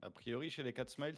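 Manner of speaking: usual speaking voice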